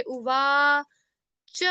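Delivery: उवाच said slowly with the long middle वा drawn out; long vowel stretched. Shown as a demonstration. Teacher stretching उवाच's long vowel for pupils. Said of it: "तो इसलिए हम लंबा करके पढ़ रहे".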